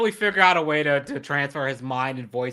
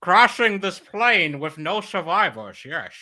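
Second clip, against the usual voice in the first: Bane impression; 3 semitones higher, 4 dB louder, slower than usual. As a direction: gloat slowly like Bane. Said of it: "more Sean Connery than Bane".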